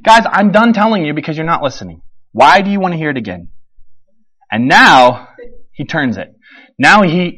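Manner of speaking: ordinary delivery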